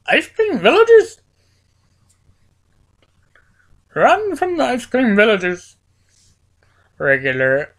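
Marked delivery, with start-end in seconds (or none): 0.1-5.8 high, loud alarmed cry; alarmed shout. Acted. Gamer yelling to himself to flee the villagers, alarmed shout. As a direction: yell a warning and flee.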